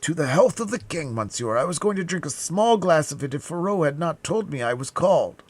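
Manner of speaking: high-pitched, flustered confession; frightened servant. A narrator's reading. Planchet explaining to his master, high frightened voice. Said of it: "more dead than alive".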